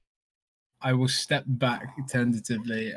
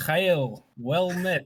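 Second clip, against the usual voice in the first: put-on Russian accent; 6 semitones higher, much slower than usual. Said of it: "in a Russian accent of it".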